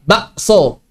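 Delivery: "Bakso" with a catch for the k, ba'-so; k as glottal stop. Shown as a demonstration. Teacher showing Javanese speech, glottal stop. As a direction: stop the throat between ba and so in "Bakso". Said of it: "diibdal jadi hamzah".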